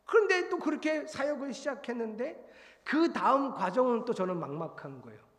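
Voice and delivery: ordinary delivery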